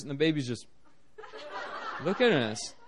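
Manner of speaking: normal talking voice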